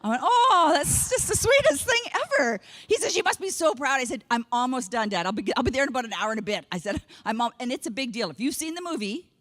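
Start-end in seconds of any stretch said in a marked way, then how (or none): none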